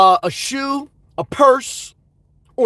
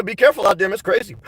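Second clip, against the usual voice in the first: ordinary talk, nothing marked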